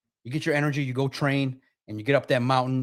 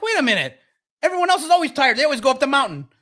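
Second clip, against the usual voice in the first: much higher and louder, indignant; exasperated protest; 9 semitones higher, 5 dB louder, faster than usual. Retold or imitated. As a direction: plead with the quitting guide. Exasperated climber protesting Sherpa.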